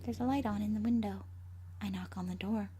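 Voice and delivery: plain reading voice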